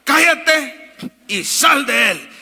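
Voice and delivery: loud, slow commanding shout, every word weighed; stern rebuke. A narrator's reading. Jesus rebuking the unclean spirit, a slow loud command. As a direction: order the spirit out with full authority. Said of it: "Jesús le reprendió diciendo".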